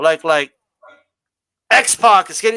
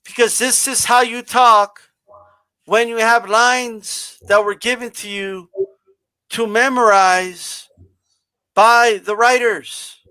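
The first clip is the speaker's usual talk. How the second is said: stiff scripted promo talk; slower than usual. Shown as a demonstration. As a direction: drag out each phrase like a bad wrestling promo read. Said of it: "like this".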